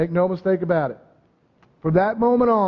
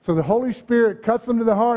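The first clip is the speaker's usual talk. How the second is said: usual speaking voice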